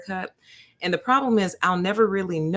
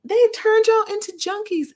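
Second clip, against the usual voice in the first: very high, slow, louder exclaim; high teasing disbelief; 12 semitones higher, 4 dB louder, slower than usual. Retold or imitated. To the nurse again, high loud playful disbelief. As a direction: shriek it up in playful shock. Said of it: "I said".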